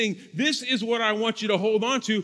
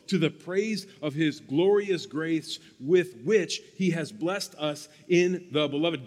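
hushed, lower-pitched Bible reading; scripture reading; 3 semitones lower than usual. Acted. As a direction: read the passage aloud, measured and reverent. Reads a Bible passage aloud.

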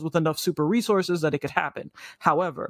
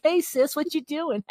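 much higher mocking sibling voice; high sarcastic sibling impression; mock casual sister; 10 semitones higher than usual. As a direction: send up a goofy family scene, pitched way up. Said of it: "And it's like".

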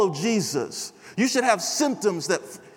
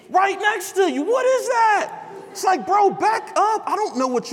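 high-pitched mock outrage; 10 semitones higher than usual. Mock rant at close-sitting stranger.

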